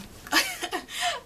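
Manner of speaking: high, loud burst of laughter. Real, not acted. Student listing favourite foods from home, high laughing.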